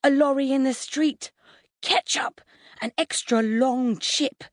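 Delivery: put-on high-pitched childlike wonder; disbelieving joy. A narrator's reading. Four-year-old greeting everyday things, joyful disbelief. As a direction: marvel at each thing like a small boy. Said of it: "He greets most life events with disbelieving joy".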